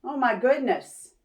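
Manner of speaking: plain reading voice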